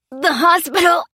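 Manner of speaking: high, strained answer in pain; pained groan. Acted. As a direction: groan it out, broken. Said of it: "groaned the man".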